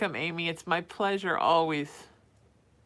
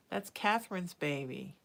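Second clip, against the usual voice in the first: normal talking voice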